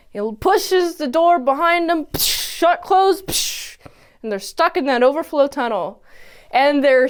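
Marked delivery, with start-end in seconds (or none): 2.1-2.6 soft hissed "psh"; door-slam sound effect. Retold or imitated. Narrator mimics door pushed behind the brothers, hissed sound effect. 3.2-3.8 quiet hissy "psh" noise; door-slam sound effect. Retold or imitated. Narrator acts out door closing on the brothers, hissed sound effect.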